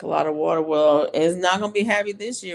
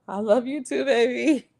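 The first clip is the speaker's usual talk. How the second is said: doting mom reply; 7 semitones higher, 4 dB louder than usual. Retold or imitated. Mother answering her son's text, tender and doting. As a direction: coo it back to your boy. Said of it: "That's what I say all the time".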